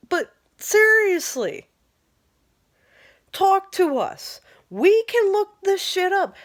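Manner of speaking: venting real fury, pitch climbing; real anger. Real, not acted. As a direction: let the anger push it up high. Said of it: "I'm really mad".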